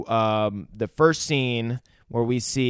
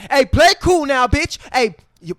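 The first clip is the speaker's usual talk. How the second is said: higher, loud, firm order to a panicked woman; commanding gangster; 13 semitones higher, 8 dB louder than usual. Retold or imitated. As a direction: take control of the room. Jules ordering Honey Bunny to calm.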